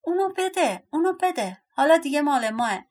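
high, insistent storybook cry; demanding shout. Acted. Dwarfs grabbing at the blanket, high demanding shout. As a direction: clamour for it, pitched well up. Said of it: "فریاد زدن".

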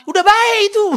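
very loud, high Batak holler; shouted Medan Batak greeting; Batak speech. Shown as a demonstration. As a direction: banter that sounds like a Batak brawl. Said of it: "orang Batak ngomong, antara bercanda sama berkelahi bingung".

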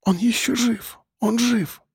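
soft, high, trembling whisper; дрожащий шёпот. A narrator's reading. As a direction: whisper it, voice shaking with hope. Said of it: "прошептала она".

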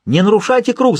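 high, urgent shouted order; shouting. A narrator's reading. Medium shouting at sitters not to break the circle. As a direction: cry out a command to stop them. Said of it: "закричал Перри".

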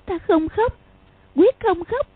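put-on high, stubborn child's voice; a girl steeling herself not to cry; girl silently vowing not to cry; thinking to herself. A narrator's reading. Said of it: "nghĩ thầm".

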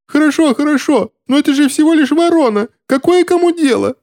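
very high-pitched squealing plea; frantic excuse. A narrator's reading. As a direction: squeal it as if cornered. Cowering man keeps making excuses.

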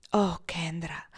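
a tender whispered sigh. Acted. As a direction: breathe her name sadly. Tender sigh to his sweetheart.

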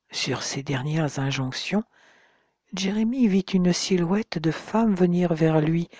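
usual speaking voice

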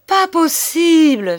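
high, slow disbelieving cry; astonished old man; stunned. A narrator's reading. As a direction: gasp it out, stunned. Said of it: "stupéfié".

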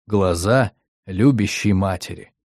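plain reading voice

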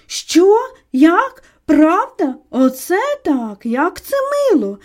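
loud, rising excited questions, then drawn-out delight; high, gushing surprise; astonished delight. A narrator's reading. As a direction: react with thrilled disbelief. Lady thrilled to find the author.

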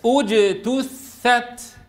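slow اجتثت, ج closed as a hard "j"; strong voiced jeem. Shown as a demonstration. Teacher models for repetition, slow firm ج in اجتثت. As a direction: draw out اجتثت, shutting firmly on the voiced ج. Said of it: "بننطقها شديدة مجهورة".

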